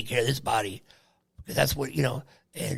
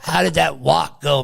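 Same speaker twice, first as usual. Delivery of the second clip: ordinary talk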